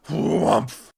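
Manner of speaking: loud, drawn-out whumph; imitated impact. A narrator's reading. Narrator as the machine lands, loud imitated thud. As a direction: voice a heavy landing with force.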